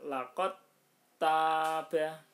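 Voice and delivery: "Laqad taba" recited loud, the d dropped into a doubled t; "Laqad taba" in loud tajwid recitation, no d heard; merged dal into ta. Shown as a demonstration. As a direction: chant "Laqad taba" as laqat-taba, long first a in taba. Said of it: "taknya ditasdidkan".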